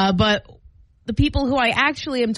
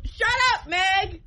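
pitched way up like a held-back yell; mock shout; 8 semitones higher, 3 dB quieter, slower than usual. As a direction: imitate a heckler calling out. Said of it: "he would just shout out".

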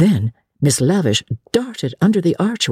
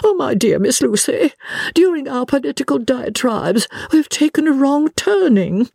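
high, fluttering lament; dismayed, dramatic lady; 11 semitones higher than usual. Acted. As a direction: bewail the mistake to your young companion. Miss Lavish laments.

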